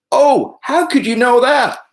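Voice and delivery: hammed-up shocked young hero; Luke's reply. Luke answers Vader, acted.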